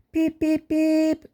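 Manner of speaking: engine whistle in a high voice; high whistle peep. A narrator's reading. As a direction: toot it high and bright. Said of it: "whistled Peter Sam".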